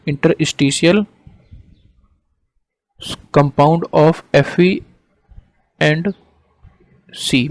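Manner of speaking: slow, measured dictation; word by word, with pauses for writing. Real, not acted. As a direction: dictate slowly for note-taking. Teacher dictating a definition for notes.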